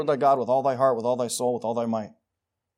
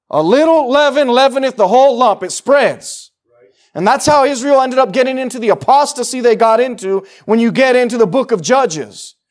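higher, louder preaching that drives every line; full-force preaching; 8 semitones higher, 13 dB louder than usual. Acted. Preacher warning the church about apostasy, full-force preaching. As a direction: thunder it at the congregation.

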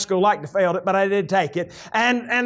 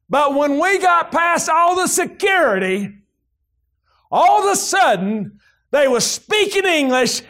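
full-force preaching; 5 semitones higher than usual. Acted. Pastor preaching the embassy story to his congregation, shouting. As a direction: preach it out to the rafters.

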